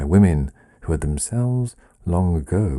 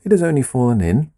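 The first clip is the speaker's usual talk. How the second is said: put-on higher, louder and fast, an eager child's call; boy calling out; 8 semitones higher, 5 dB louder, much faster than usual. A narrator's reading. Son in the fountain calls up to his mother, higher, louder and fast. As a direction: shout it up the steps, bright with purpose and hurrying. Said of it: "he called".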